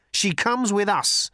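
slow, high, firm demand; insistent. A narrator's reading. Arthur confronts Thor.

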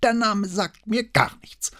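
put-on slow, high, testy old man's voice; prickly old notary, pitched high; irritated old man. A narrator's reading. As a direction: deny it slowly with audible irritation. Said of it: "mit hörbarer Gereiztheit in der Stimme".

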